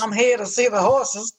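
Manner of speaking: lightly mocked fake Southern accent; fake Louisville accent. Guest mocking actors claiming to be from Louisville, fake Southern accent. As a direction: mimic a put-on Louisville Southerner. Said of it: "a New Orleans accent and then say they're from Louisville".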